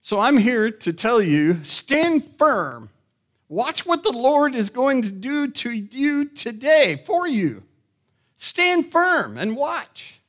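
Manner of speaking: high, loud rallying proclamation; bold preaching. Acted. Preacher telling the congregation to stand firm, loudly proclaiming. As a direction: declare it with full pulpit fervour.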